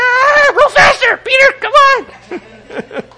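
high, loud, slow panicked yelling at a rower; hammed-up disciple terrified in a storm; mock panicked shout. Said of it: "You're like".

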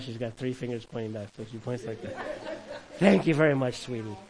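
ordinary delivery

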